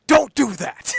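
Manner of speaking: high, loud alarmed yell; alarmed shout. Retold or imitated. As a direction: snap at him to stop. Mandalorian yelling at robot.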